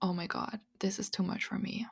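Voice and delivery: soft, unvoiced private thought; hushed overwhelmed aside; overwhelmed inner voice. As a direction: murmur it to yourself, overwhelmed. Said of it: "you're thinking to yourself".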